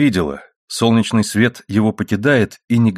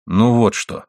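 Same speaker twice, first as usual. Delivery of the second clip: frowning; 2 semitones higher, slower than usual. A narrator's reading. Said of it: "хмурясь сказал Пол".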